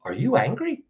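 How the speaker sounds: "angry" rising as a dumb question; stupid question. Shown as a demonstration. Advisor mocking a clumsy question to an angry person, pointed rise. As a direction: ask "Are you angry?" with a pointed upward tune. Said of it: "stupid question mark".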